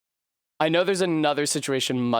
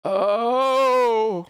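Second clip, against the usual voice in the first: anguished oh; 6 semitones higher, 4 dB louder than usual. Real, not acted. After the fall.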